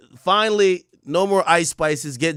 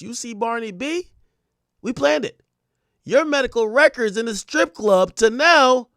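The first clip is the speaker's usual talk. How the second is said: high diva taunt, growing louder; 8 semitones higher, 5 dB louder than usual. Acted. Lecturing [name] about planning, high diva impression. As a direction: imitate a lecturing star, pitched way up. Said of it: "read this in a [name] voice".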